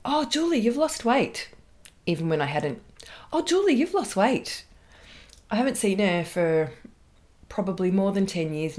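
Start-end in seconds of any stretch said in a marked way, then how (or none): 0.0-1.5 chirpy colleague. Retold or imitated. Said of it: "she would comment on my weight". 3.3-4.7 high, chirpy sing-song; chirpy colleague. Retold or imitated. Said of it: "she would comment on my weight".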